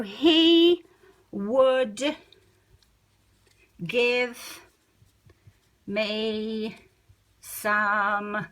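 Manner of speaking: one word at a time, long gaps between; slow dictation for writing down; dictating slowly. Shown as a demonstration. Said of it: "let's just write that down".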